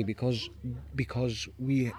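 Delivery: ordinary talk, nothing marked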